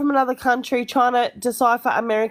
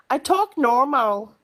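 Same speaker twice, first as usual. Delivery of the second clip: slow, drawn-out copy of Midwest American; imitated American accent; slower than usual. Aussie mimics how the American said normal, drawn-out American accent. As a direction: stretch it into mock American vowels. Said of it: "you said".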